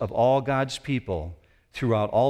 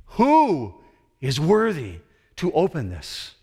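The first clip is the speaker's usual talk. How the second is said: higher and louder, a herald calling across eternity; booming angel voice; 8 semitones higher, 4 dB louder than usual. Acted. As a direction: proclaim it like an angel summoning all creation. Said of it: "The angel's voice just resonates".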